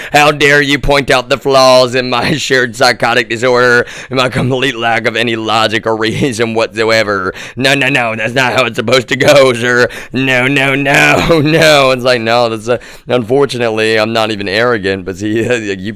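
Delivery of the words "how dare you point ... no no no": higher, loud mock-offended grandma. Grandson mocking grandma's huffy protest, higher and loud. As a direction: ham up a huffy old lady. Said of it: "she's like".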